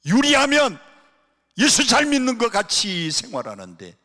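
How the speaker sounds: loud, high exhortation; forceful preaching. Real, not acted. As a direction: ring out the accusation to the congregation. Contrasting confident believers with hiders.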